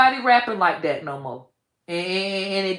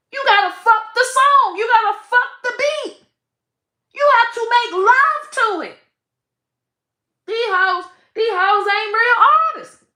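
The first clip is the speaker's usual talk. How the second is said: high and loud, hammering the point; fired-up preaching; 12 semitones higher, 5 dB louder than usual. Real, not acted. Rapper urging artists, fired up and loud. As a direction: push it like a sermon on rhythm.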